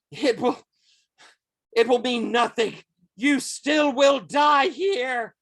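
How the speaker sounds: high, loud, strained threat; defiant villain. Acted. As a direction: stagger back and cry out your threat. Wounded villain threatens the players.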